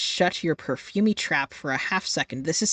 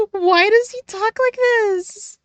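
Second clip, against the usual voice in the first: very high, louder, slow squeal; mocking very high voice; 4 dB louder, slower than usual. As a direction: push the mockery even higher and louder.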